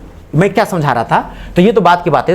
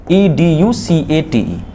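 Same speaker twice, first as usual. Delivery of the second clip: spelling out; 3 semitones lower, much slower than usual. Shown as a demonstration. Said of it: "spelling a word letter by letter".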